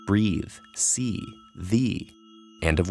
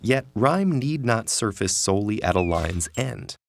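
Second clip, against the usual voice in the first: usual speaking voice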